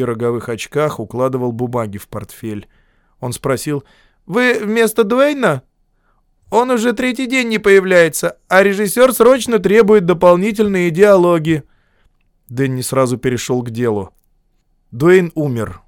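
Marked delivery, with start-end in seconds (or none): 4.3-11.6 put-on nervous young man. A narrator's reading. Nervous young editor voiced.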